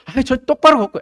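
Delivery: high, loud mock whine of denial; young crab protesting. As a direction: protest as the scolded child. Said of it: "개 엄마가 그런 거예요".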